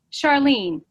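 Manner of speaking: ordinary talk, nothing marked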